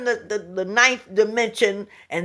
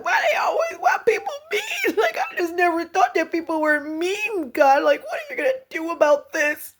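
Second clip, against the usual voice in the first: much higher, wailing cry-laugh; crying Charlie Brown; 10 semitones higher than usual. Retold or imitated. Woman mimicking herself crying to Divine, high wail. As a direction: imitate her own blubbering sobs. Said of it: "I sound like Charlie Brown 'cause I was crying".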